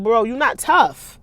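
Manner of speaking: mock Donnie. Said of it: "Donnie already know, like".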